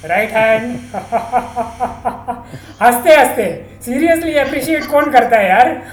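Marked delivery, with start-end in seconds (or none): 3.9-5.8 fast words bubbling with real laughter; laughing through words. Real, not acted. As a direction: blurt it out while cracking up. Laughing while told to appreciate.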